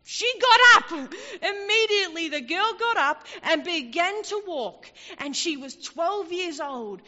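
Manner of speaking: preacher shouting the miracle to the congregation, exultant; full-force preaching. Acted. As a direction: preach the miracle with rising fire.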